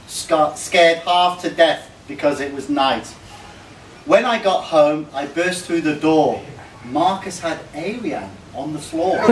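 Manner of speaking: ordinary talk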